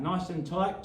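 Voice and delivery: usual speaking voice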